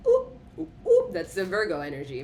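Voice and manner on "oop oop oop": high squeaky oop oop cries; playful high-pitched oop noises; squealing oops. Acted. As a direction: yelp it way up high. Virgo voice trailing into squeal.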